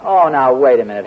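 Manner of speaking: ordinary delivery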